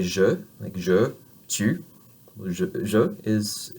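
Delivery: je with a relaxed schwa vowel; French je. Shown as a demonstration. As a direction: say je loose, mouth relaxed. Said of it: "if you say".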